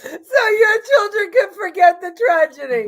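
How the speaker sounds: loud, high fake sobbing; hammed-up crying shout; mock wailing. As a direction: wail it like a hammed-up mourner.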